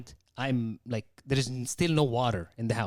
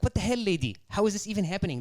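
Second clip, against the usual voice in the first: high, retold indignant questioning; exasperated confrontation; 7 semitones higher, faster than usual. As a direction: confront them in mock outrage, pitched up. Acted phone quarrel over the leak.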